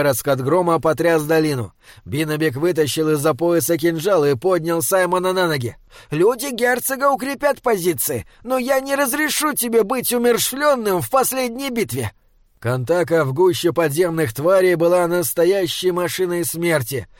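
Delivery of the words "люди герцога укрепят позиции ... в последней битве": high-pitched, urgent troll; earnest protest, pitched well up; insistent troll guardian. Acted. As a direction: argue firmly in a high voice. Troll's figure voice in narration.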